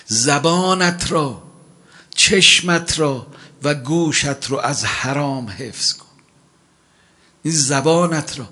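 sermon at full force; fiery warning. Acted. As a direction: drive the warning home slowly. Preacher's urgent warning.

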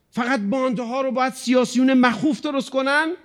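high-pitched, quickening preacher's scolding; rising preaching rebuke. Acted. Preacher scolding the congregation for living alone, high rebuke. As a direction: press them with pointed questions.

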